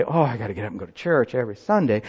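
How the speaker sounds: grumbling churchgoer. Retold or imitated. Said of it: "I know you say".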